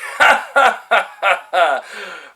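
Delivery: high, loud spaced-out laugh; put-on staccato cackle; mocking laugh. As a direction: laugh scornfully in slow, even bursts. Mocks his mistaken guess.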